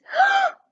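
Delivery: high, loud shocked gasp. Acted. Murphy seeing the shape, gasping loudly. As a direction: gasp like you've seen the monster. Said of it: "Murphy screamed".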